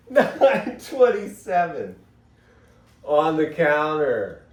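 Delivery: giggling it out as he reads the clock; laughing. Real, not acted. Amused at the clock time, laughing the numbers out.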